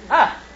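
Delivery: sharp high yelp of surprise; a quick high startled "ah"; startled cry. Acted. Nero yelps when he spots his mother.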